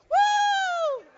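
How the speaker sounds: put-on high shrieking woo of thrill-seeking kids; kids' woo. Kids on the coaster whooping with joy, a huge high woo. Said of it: "the crazy kids, they're like".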